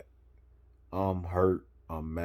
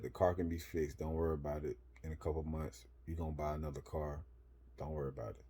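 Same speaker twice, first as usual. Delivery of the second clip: reassuring friend; 3 semitones lower, 10 dB quieter, faster than usual. Retold or imitated. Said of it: "what he said".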